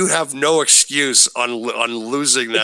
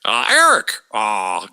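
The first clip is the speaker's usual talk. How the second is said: Bischoff's Ted Turner impression; slower than usual. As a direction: mimic Ted's halting uh sounds. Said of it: "where he was just like".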